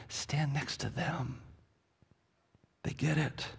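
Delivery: usual speaking voice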